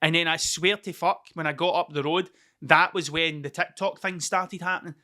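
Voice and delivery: ordinary delivery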